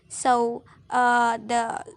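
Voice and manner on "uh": ordinary delivery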